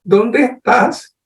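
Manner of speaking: God calmly calls Adam out of hiding; calm gentle call. Retold or imitated. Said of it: "Dios llega tranquilo y le dice".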